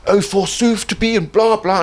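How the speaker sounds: high, loud hammed-up theatre shouting; mock posh Shakespeare actor. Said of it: "all this shouting with these posh voices".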